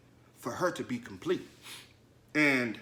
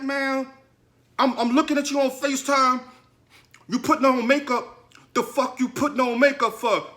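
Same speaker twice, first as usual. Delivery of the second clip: loud, high-pitched retold tirade; indignant, raised-voice outburst, acted out; blowing up; 10 semitones higher, 8 dB louder than usual. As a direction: reenact blowing up at your girlfriend. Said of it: "I'm blowing up at her".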